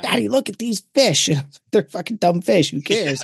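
put-on high, eager little boy voice; excited kid voice. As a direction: play a thrilled child. Son quoted at the fish.

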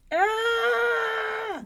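put-on long, high baby wail; loud held bawl; baby's holler. A narrator's reading. Baby joining the giant's noise, hollering. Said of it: "The baby began to holler too".

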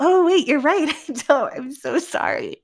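high, amused sudden backdown; laughing apology. Retold or imitated. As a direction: concede the point through giggles. Retells realizing mid-argument, laughing.

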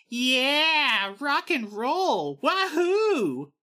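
high, loud, stretched mock cheer. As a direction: whoop it out like a rocker. Quoted band member cheering.

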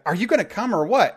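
retelling the actress's disgusted look as an impatient call; imitating disgusted impatience. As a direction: toss it at him like he's wasting her time. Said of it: "she gives him this disgusted look like".

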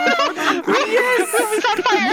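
high, loud excited cheer. Real, not acted. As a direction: cheer it out, thrilled. Celebrating after zombie noise.

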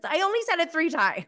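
high, fast quip imitating Lisa; a quick high mock retort; chiming in. Lisa's quip to Monica, imitated high and fast. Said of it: "she chimes in".

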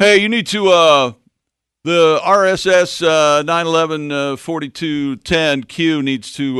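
pushy know-it-all caller, slow; know-it-all listener. Retold or imitated. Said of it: "this is my know-it-all listener voice".

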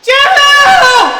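full-voiced very high scream. A narrator's reading. Boy screams after the lost girl, desperate scream. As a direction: scream her name into the wilds. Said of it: "he screamed".

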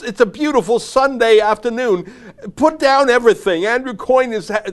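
high, loud gushing in an odd put-on accent; hammed-up frantic excitement. Mock viewer thrilled about a politician's livestream, hammed-up frenzy. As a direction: shriek it like an overexcited fan. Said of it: "I don't even know what accent that was".

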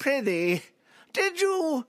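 high, loud Shakespearean voice; hammy stage actor. Acted. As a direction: overact it like a Shakespearean ham. Mock archaic stage banter.

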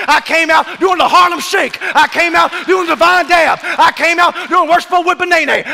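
rising joyful shout, high and loud; building excitement. Real, not acted. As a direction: preach it up into celebration. Preacher builds to celebration.

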